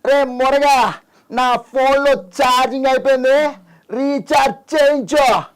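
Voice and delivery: very high-pitched film-dialogue swagger; Allu Arjun. Acted. Guest performs his viral film dialogue for the host, high mimicry voice. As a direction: pitch it way up, stretch the phrase endings. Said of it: "నువ్వు ఇది అల్లు అర్జున్ అనగానే".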